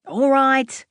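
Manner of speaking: loud, grudging all right; loud reluctant agreement. Acted. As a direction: give in loudly. Seb giving in to Lucy.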